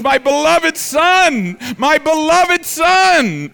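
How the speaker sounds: high, loud, slow gushing; doting father. Retold or imitated. Father gushing over his newborn son, high, loud and slow. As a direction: swoon over the baby, drawing it out. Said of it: "but he goes".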